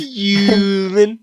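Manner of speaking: high, drawn-out teasing echo; mock fuming. Friend echoing how Owen felt, teasingly drawn out. Friend echoes the word mockingly.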